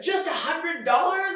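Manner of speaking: high, slow, shouted disbelief; indignant at a tiny gift; mock outraged mother.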